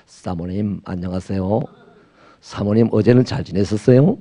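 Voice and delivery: low, soft, oily sweet talk; a hint of a crooning flatterer; smooth-talking gigolo. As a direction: coax her gently like a charmer. Swallow sweet-talking a lady.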